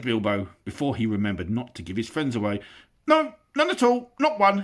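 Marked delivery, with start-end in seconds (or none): 3.1-4.6 very high loud hasty denial; panicked hobbit. Acted. Bilbo hastily denies, very high. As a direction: take it back in a rush.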